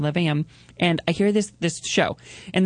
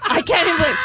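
thrilled laughing; 13 semitones higher than usual. Retold or imitated. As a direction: gush through laughter at the story. Excited retelling, laughing.

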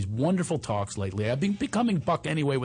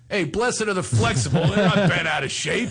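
louder, higher mock cowboy accent with a drawn "Hey"; 6 semitones higher, 5 dB louder than usual. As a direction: ham up a cowboy accent to show the difference. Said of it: "It's coming out of a cowboy accent".

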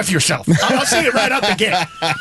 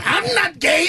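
higher, shouted denial; indignant uncle; 9 semitones higher than usual. Retold or imitated. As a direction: fling it over your shoulder. Uncle storms off denying.

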